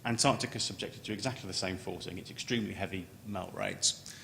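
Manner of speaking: ordinary delivery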